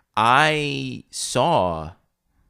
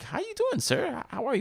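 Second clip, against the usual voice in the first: much higher, nervous polite voice; nervous polite greeting; 3 dB quieter, faster than usual. Retold or imitated. Greeting the ex's father, high nervous politeness. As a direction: squeak out a polite hello. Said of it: "I was like".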